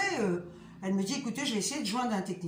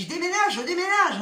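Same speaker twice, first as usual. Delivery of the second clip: higher, louder mock-exasperated declaration; 6 semitones higher, 5 dB louder than usual. Fed up with the blocked pipes, exasperated higher louder outburst. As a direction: blurt out the threat to move out in exasperation. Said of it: "j'étais énervée".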